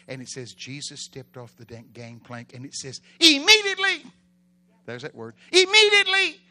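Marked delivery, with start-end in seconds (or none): 3.2-4.0 high, explosive single word; shouted exclamation. Acted. Shouts Mark's favourite word of action. 5.5-6.4 loud, high outburst; shouted exclamation. Acted. Shouts the highlighted word again.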